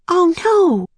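put-on high, bright little girl voice; young girl Annie. A narrator's reading. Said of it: "said Annie".

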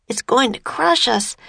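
high-pitched horrified thought. A narrator's reading. Jack watching the serpent coil round the island, horrified. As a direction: voice lifted in alarm, not shouted. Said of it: "Jack thought with horror".

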